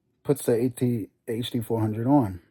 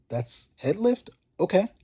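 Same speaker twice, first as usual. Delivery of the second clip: disbelieving question; 6 semitones higher than usual. Retold or imitated. Learning the problem is head lift.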